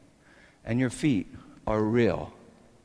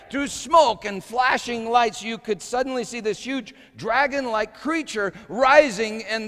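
higher, louder building story; intense storytelling; 12 semitones higher, 6 dB louder than usual. Acted. Preacher telling the ride story to his church, louder and higher. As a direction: drive the scene higher and harder.

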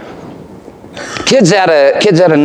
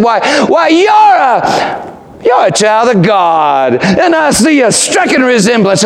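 preacher's proclamation; 7 semitones higher than usual. Retold or imitated. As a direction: announce it with joy. Preacher's warm revelation.

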